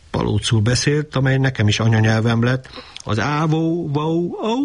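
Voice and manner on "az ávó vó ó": higher barking echo of the word; barked. A narrator's reading. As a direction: bark the syllables out like a dog. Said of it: "akár az ugatás". (magasabb, ugatásszerű szótagok)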